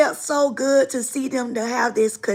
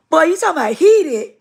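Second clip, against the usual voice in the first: ordinary delivery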